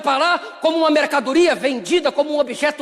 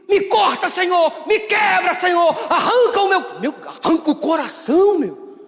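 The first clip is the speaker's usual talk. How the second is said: mock fervent prayer shout; 5 semitones higher than usual. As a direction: shout the plea to God, pitch way up. Believer's dramatic prayer for pruning.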